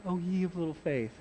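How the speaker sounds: soft, hushed pious recital. Shown as a demonstration. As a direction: murmur it like a gentle saint. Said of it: "doesn't say that all piously".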